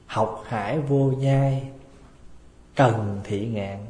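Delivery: slow, measured recitation of a Sino-Vietnamese saying; slow recited proverb. Shown as a demonstration. Monk reciting Chinese proverb to the congregation, slow and measured. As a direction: intone the old proverb slowly. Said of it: "cái câu tiếng Hán".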